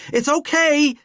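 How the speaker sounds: high, loud shout over screaming. A narrator's reading. Valkyrie shouting over the terrified pair's screams. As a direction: yell to cut through the panic. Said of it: "Valkyrie shouted over their screams".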